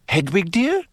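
high, vague motherly question; distracted. A narrator's reading. Mother half-hearing Harry's question, distracted. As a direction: answer with your mind elsewhere. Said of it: "said Missus Weasley distractedly".

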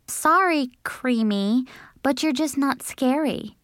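put-on high-pitched girl's brush-off; dismissive Cinderella. A narrator's reading. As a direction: let the clown down in a high voice.

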